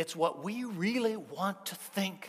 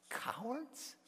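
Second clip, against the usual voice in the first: incredulous echo; 8 semitones higher, 10 dB quieter than usual. Acted.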